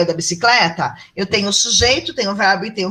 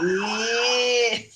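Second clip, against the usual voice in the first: ordinary talk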